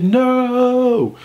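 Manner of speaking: long, high drawn-out wail; hammed-up slow-motion movie "no"; dramatic cry. Seeing a gun on his roommate, desperate cry.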